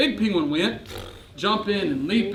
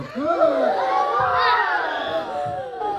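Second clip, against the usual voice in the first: long drawn-out gasp of amazement; playful stage gasp for the kids; big theatrical gasp; 6 semitones higher than usual. Acted. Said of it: "The crowd gasped in amazement".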